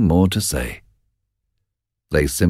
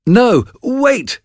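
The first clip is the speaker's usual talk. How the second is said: high, louder urgent cry; cried out; 4 dB louder than usual. Acted. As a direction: call them back in alarm. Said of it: "cried Macbeth".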